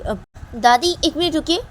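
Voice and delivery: put-on child calling grandma.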